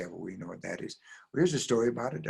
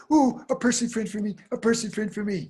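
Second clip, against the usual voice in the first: put-on high, excited child voice; thrilled little girl; 10 semitones higher, 4 dB louder than usual. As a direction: squeal with a child's delight. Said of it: "Little girl said".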